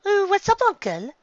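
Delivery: eager niece answering; Songbreeze voice. Acted. Songbreeze answers her uncle's call.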